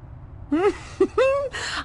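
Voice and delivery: high, bright burst of laughter; a delighted high laugh; amused laugh. Acted. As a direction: laugh at your own joke. Amused by her own quip.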